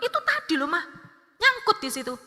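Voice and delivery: imitated child's high-pitched, testy comeback; snappy retort. Child explaining the snag to mother, snappy. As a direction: answer back defensively, pitch shooting up. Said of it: "langsung kalimatnya tuh ngegas".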